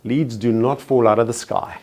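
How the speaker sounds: usual speaking voice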